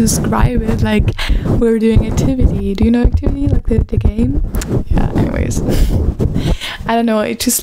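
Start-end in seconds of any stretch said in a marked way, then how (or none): none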